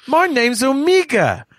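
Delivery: put-on thick Australian accent, high and drawn out; Omega. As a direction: mimic a strong Australian accent, slow and high-pitched. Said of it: "is that a strong accent?".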